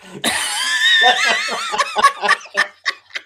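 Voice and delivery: high, loud shrieking burst of laughter; cracking up hard at a joke. Real, not acted. Howling with laughter at his friend's joke.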